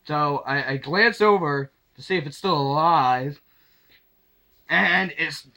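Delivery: ordinary talk, nothing marked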